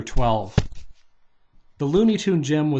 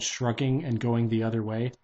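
ordinary delivery, nothing marked

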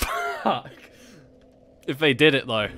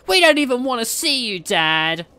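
put-on kid voice; 10 semitones higher, 4 dB louder than usual. Kids voiced.

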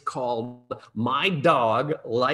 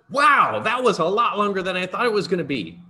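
real surprise, higher and louder; surprised; 6 semitones higher, 5 dB louder than usual. Real, not acted. Author noticing his talk ran long, genuinely surprised. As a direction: caught off guard by the clock. Gasps at the time.

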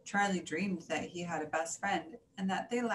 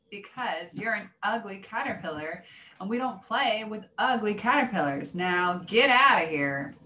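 scornful rejection; 8 dB louder than usual. A narrator's reading. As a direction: reject them, ending in a loud order. Rabbits harshly reject Charlie.

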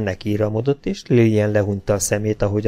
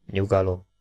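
soft, slightly lowered resonant voice; quiet deep baritone; 3 semitones lower, 6 dB quieter than usual. A narrator's reading. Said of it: "mély zengésű baritonján halkon ezt mondta".

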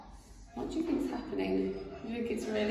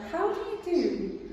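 much higher prim duck greeting; polite duck; 10 semitones higher than usual. Acted. Duck greeting the rat, prim and high. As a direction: greet him sweetly.

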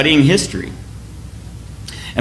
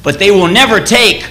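Wallace rallying troops; 4 semitones higher, slower than usual. Retold or imitated. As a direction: stir the deserters to fight. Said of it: "William Wallace says".

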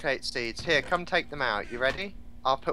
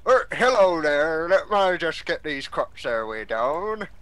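farmer voice. Pretend. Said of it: "speak in a farmer's voice".

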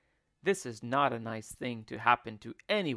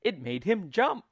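ordinary delivery

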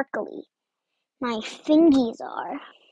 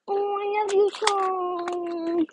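high, slow, drawn-out gush; gushing affection; 5 semitones higher, slower than usual. Retold or imitated. Friend replying to a tearful apology, gushing. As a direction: stretch the words out sweetly, pitched up.

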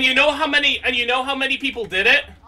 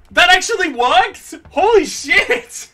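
higher and louder, thrilled; excited; 6 semitones higher, 6 dB louder, slower than usual. Real, not acted. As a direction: shout it out in disbelief. Trick succeeded.

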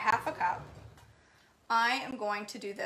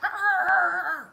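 loud, high mock growl of frustration; hammed-up annoyed roar; frustrated growl; 9 semitones higher, 7 dB louder than usual. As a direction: let out an exasperated arr. Nail tech's frustrated outburst.

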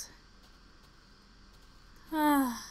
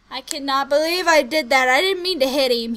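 louder dismayed; can't believe what I did; real dismay; loud guilt; 7 dB louder than usual. Real, not acted.

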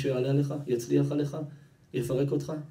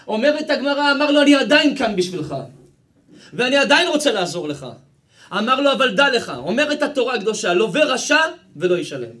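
very loud, high preacher's shout; impassioned preaching; 9 semitones higher, 11 dB louder than usual. Acted. Preacher voicing the worker's offer from the Gemara, impassioned preaching. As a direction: thunder the holy text at the congregation, pitched high.

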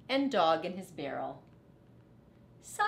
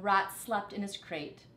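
ordinary delivery, nothing marked

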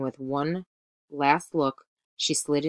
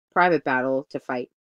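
ordinary delivery

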